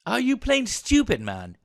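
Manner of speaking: high, loud burst of disbelief, dropping on the last word; exasperated scolding. Acted. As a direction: scold a reckless subordinate. Officer scolds private over grenade.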